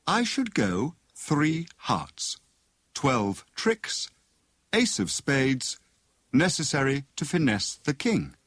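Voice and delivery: deliberate, puzzling reading; reading an ad aloud. A narrator's reading. Tuppence reads advertisement aloud.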